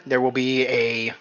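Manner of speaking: ordinary delivery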